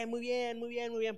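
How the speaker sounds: gushing approval with no substance; flattering pastor. Retold or imitated. Shallow pastor praising members, mocking and gushing. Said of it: "que solo diga".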